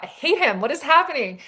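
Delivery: lightly voiced heroine, thrown off; a flustered heroine caught off guard; flustered surprise. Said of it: "And she's like".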